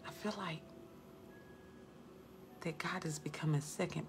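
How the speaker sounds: soft whispered confession, low and hushed; whispering. Retold or imitated. Confessing to a client that God came second, hushed whisper. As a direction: whisper it as if heaven might overhear. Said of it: "I needed to whisper so heaven wouldn't hear".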